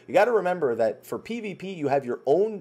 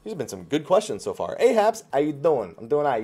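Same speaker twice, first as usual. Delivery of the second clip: ordinary talk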